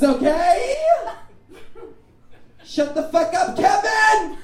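high, furious full-out scream; night-terror yelling. Acted. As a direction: scream at Kevin in exasperation, drawing out the words. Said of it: "I yell terrible things in my sleep".

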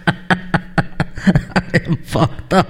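slow, laughing and half-whispered; giggling stoned pilot. Acted. Stoned pilot laughing at the cockpit buttons. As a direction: giggle through every word, slowly.

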